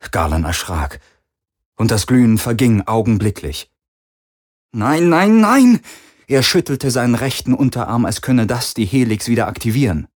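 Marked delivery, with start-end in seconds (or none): 4.7-5.8 rising, much louder cry of despair; desperate. Acted. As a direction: plead louder each time. Helix glow fades, despair.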